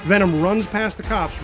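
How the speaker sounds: usual speaking voice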